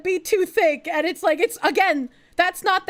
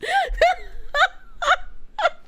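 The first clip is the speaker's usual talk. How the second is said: laughing hard, much higher; wheezing laughter at dad's rudeness; long high laugh. Real, not acted. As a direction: crack up helplessly.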